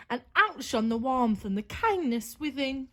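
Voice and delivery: ordinary delivery, nothing marked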